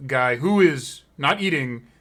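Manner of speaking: usual speaking voice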